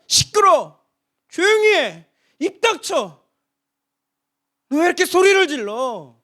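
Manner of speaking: high, loud, scolding snaps; angry hush. Acted. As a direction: crowd telling the beggar to shut up. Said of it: "사람들은 이야기합니다". (군중이 짜증내며 꾸짖는 말투)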